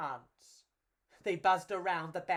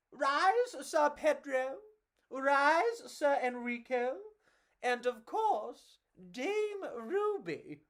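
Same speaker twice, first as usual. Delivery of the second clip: higher, louder slow proclamation; crowd roar; 7 semitones higher, 4 dB louder than usual. Acted. The crowd roaring to honour the heroes. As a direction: proclaim it grandly. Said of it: "the big crowd roared".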